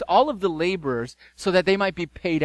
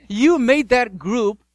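indignant complaint; 5 semitones higher, 5 dB louder than usual. Acted. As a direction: protest like cheated workers. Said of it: "And they say this".

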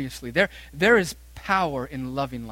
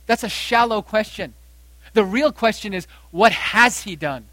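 preaching push; 4 semitones higher, 8 dB louder than usual. Acted. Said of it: "preaching at full force".

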